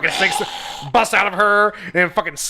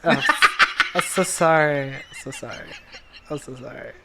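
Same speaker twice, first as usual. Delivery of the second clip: laughing apology; 2 semitones lower, 4 dB quieter than usual. Retold or imitated. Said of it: "but then I was like".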